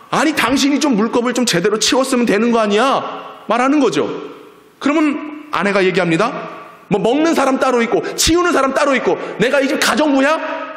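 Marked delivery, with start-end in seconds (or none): 0.1-3.4 higher-pitched acted complaint; reproachful father. Husband blaming wife over the water cup, indignant. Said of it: "그리고 아빠는 뭐라 그러냐?". 6.9-10.7 high-pitched, indignant retort; confrontational wife. Acted. Wife snapping back at blaming husband, indignant. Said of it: "이렇게 따지는 거예요".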